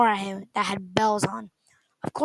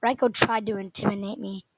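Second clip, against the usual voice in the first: usual speaking voice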